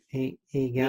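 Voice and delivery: a soft mock impression of a footballer's English voice; Beckham voice. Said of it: "He's got a voice like that".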